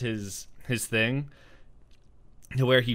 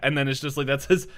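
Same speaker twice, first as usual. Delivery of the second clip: usual speaking voice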